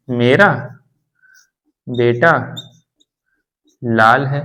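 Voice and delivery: isolated words with long pauses. Shown as a demonstration. Teacher posing a confusing example to a Hindi learner, word by word. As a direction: leave a long gap after each word.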